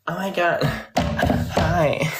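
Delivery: high, giggly flirty greeting; mock flirty neighbor. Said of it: "He was like".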